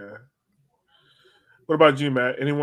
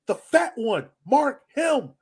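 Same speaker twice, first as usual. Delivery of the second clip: mock player shout; 10 semitones higher, 3 dB louder, much slower than usual. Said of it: "And he was just like".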